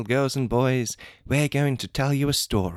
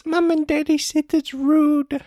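put-on high, loud, slow child whine; little kid voice; 13 semitones higher, 8 dB louder than usual. Child character.

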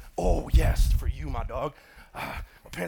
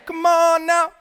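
very high, very loud holler; all-out, high-pitched preacher's yell; full-force rousing shout; 16 dB louder, slower than usual. Real, not acted. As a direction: shout it to the rafters at the congregation. Repeats the call, further raised.

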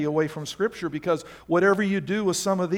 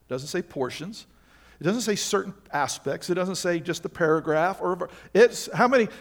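ordinary talk

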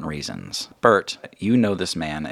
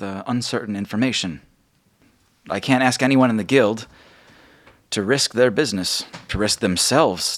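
raised, louder leader's address; steady the guild with a plan; Margot's speech; 4 semitones higher, 4 dB louder than usual. Acted. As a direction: guild leader admitting money troubles, raised and firm. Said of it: "she says".

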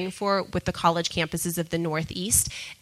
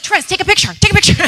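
excited urging; 11 semitones higher, 8 dB louder, much faster than usual. Retold or imitated. Lou urging excitedly to photograph.

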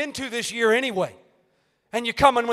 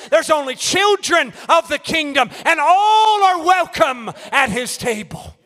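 loud, high preaching cadence; full-force preaching; 5 semitones higher, 5 dB louder than usual. Acted. Preacher rousing the church, loud and high preaching. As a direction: preach it out, hammering each line.

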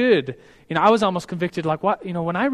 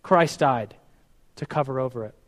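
ordinary delivery

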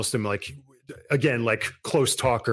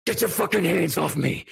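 snarling shout; 7 semitones higher than usual. Acted. Starving vampire snapping at Craig, furious shout. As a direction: lash out like a cornered beast. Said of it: "and I'm like".

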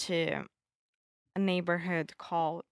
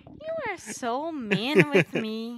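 playful protest; 5 semitones higher, 7 dB louder than usual. Real, not acted.

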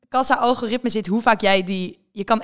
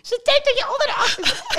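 very high, loud shrieking laughter; father's screech; 4 dB louder than usual. Retold or imitated. Father screeching at daughter over ID check, shrieking with laughter. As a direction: squeal it, doubled up laughing. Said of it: "die begint te krijsen".

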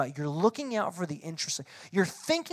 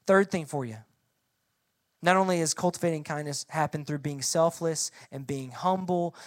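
ordinary talk, nothing marked

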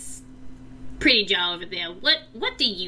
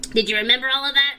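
higher, louder, fast eager goblin; chirpy goblin; 6 semitones higher, 6 dB louder, much faster than usual. Acted. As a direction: snap back to a cheerful little goblin. Scuzz drops the booming voice.

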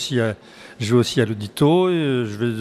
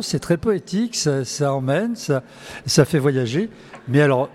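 ordinary talk, nothing marked

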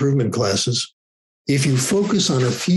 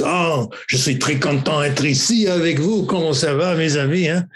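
higher, hammed-up French accent; exaggerated French accent; 4 semitones higher than usual. Student mocking his French oral exam, exaggerated French accent. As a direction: lay the French on thick, mock-ridiculous. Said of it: "he exaggerated the French accent".

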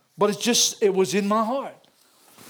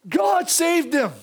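drawn-out, higher plea, retelling a prayer; retold crying out; 8 semitones higher, 3 dB louder, much slower than usual. Preacher retelling his prayer for the unsaved, crying out. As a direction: stretch it high and slow as a retold cry. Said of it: "I was crying out for them".

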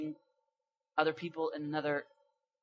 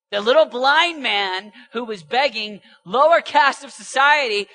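slow, high, very loud proclamation; full-force preaching; 8 semitones higher, 16 dB louder, slower than usual. Real, not acted. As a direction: preach it out, stretching each phrase.

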